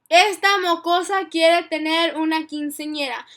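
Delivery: loud, high, panicked Spanish rant; frantic mom rant. Retold or imitated. Mom on the phone to her friend, freaking out loudly. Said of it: "she's over here all freaking out".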